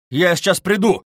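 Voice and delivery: loud parting cry; shouted. A narrator's reading. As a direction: call out hurriedly to the others. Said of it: "крикнул он".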